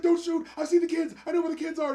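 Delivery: high, urgent begging for his life; acted panicked pleading. Junkie held at gunpoint by a cop, panicked pleading.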